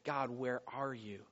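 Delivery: low, slow, soft plea; a hushed, heavy question to God; subdued cry to God. Acted. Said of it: "we cry out to God and say".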